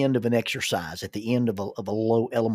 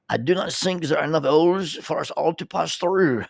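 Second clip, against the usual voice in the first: higher-pitched French accent, imitating a guest; 5 semitones higher, 4 dB louder than usual. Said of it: "He had a real French accent".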